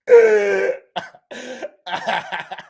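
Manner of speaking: high, loud burst of laughter; laughing. Real, not acted. Streamer amused by own scheming, real high laughter. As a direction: crack up at your own joke.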